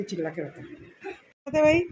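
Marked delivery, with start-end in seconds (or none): 1.5-1.8 sudden high "अरे भाई"; startled exclamation; surprised outcry. Real, not acted. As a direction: call out in alarm. Exclaiming at the crying child.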